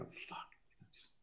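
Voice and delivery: barely audible whispered cursing; imitating someone swearing under their breath; quiet distant muttering. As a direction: whisper it to yourself, fed up. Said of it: "I can hear her talk real quietly in the distance".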